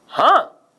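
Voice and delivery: हाँ in one loud, high burst; हाँ punched out as one unbroken syllable; one-stroke syllable. Shown as a demonstration. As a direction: say हाँ whole in a single breath stroke. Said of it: "एक आघात में इसका उच्चारण हुआ".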